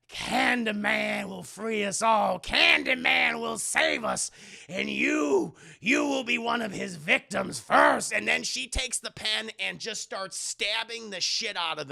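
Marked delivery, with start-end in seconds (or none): 0.1-8.1 slow fervent cult preacher; hammed-up true believer; mock zealot. Cultist cornering Brianna, slow fervent mock preaching.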